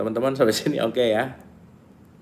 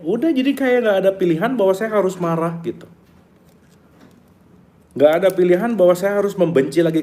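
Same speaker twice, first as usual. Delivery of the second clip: exasperated mock; 8 semitones higher, 3 dB louder than usual. Voicing the reactive mind.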